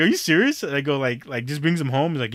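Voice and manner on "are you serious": higher incredulous question; hearing about his coworker's mom, higher and incredulous; disbelief. Retold or imitated. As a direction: ask it in disbelief. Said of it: "I was like".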